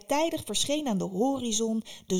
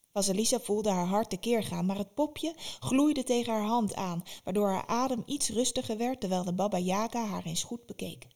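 plain reading voice

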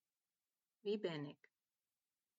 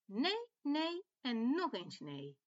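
higher, louder, emphatic refusals; angry little lamb; 6 semitones higher, 5 dB louder than usual. A narrator's reading. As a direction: stamp out each refusal like a cross lamb. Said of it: "roept lammetje Pammetje boos".

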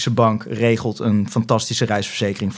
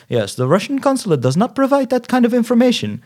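much higher mock official voice; indifferent repeated stock answer; 11 semitones higher than usual. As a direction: parrot the consulate's stock refusal in a high voice. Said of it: "echt vijf keer hetzelfde antwoord gekregen". (hoog nagedaan, onverschillig standaardantwoord)

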